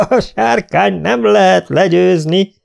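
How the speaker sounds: high, loud boast; triumphant shout. A narrator's reading. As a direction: crow it out over the field. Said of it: "kiáltotta".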